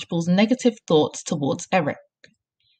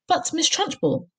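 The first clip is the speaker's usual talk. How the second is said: high-pitched, pleading objection; alarmed protest; 10 semitones higher than usual. A narrator's reading. Teacher objecting to headmistress's cruelty, pleading protest. Miss Honey protests again.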